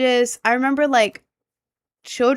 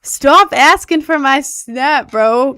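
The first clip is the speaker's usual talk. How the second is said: slow, loud, higher scolding; 5 semitones higher, 10 dB louder, slower than usual. Real, not acted. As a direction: tell them off loudly. Scolding a chat viewer.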